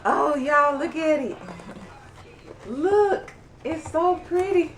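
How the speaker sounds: high, thrilled call to look; excited showing off. Real, not acted. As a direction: show it off, delighted. Showing off the pretty mug.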